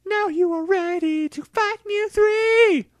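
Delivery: high, loud creature cry; a towering creature rallying its trainers at full volume; Mewtwo voice, proclaiming. Acted. Mewtwo rallies the trainers for battle, high and loud. Mewtwo sends them to fight.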